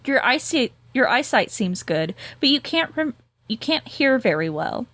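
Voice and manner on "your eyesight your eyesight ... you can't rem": normal talking voice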